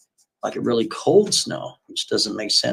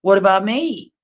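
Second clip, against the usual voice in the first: indignant woman; 9 semitones higher than usual. Retold or imitated. Kathy complaining to her date that she needs attention, indignant. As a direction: demand his attention. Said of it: "She's like".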